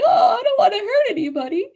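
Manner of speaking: very high, fast, laughing whimper; high mock sob, half laughing; mock crying. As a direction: whimper it like a teary little moon sign. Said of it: "little Piscean moon starts crying".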